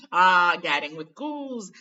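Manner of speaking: loud, slow showman exclaiming; vain Lockhart. Acted. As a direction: savour the title like a vain celebrity. Lockhart voiced by narrator.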